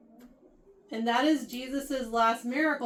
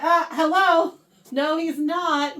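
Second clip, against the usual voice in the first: louder, incredulous protest; louder, slow no way; indignant refusal; 3 semitones higher, 7 dB louder, slower than usual. Retold or imitated. Imagined friend stopping Jesus's captors.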